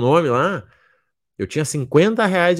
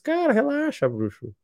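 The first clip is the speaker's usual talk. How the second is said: retold reply; 11 semitones higher, 6 dB quieter than usual. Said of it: "Eu disse".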